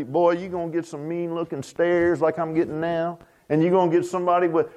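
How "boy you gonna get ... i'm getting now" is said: ordinary talk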